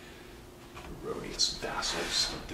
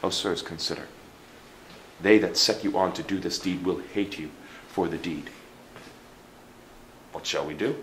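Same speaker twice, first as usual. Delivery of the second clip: normal talking voice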